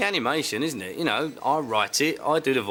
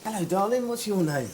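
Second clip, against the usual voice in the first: bright kiddy greeting; 7 semitones higher than usual. Real, not acted. Entertainer greeting a child at a signing, bright and higher. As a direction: greet the little fan brightly.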